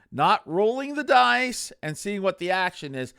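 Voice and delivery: ordinary talk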